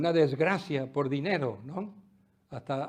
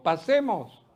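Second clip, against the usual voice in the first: "Pasemos" said slowly and firmly, leaning on it; stress on Pasemos; 3 semitones higher, 6 dB louder, slower than usual. Shown as a demonstration. Preacher drills the congregation on the word, firm stress. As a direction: land hard on "Pasemos" so they repeat it. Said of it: "Dilo conmigo".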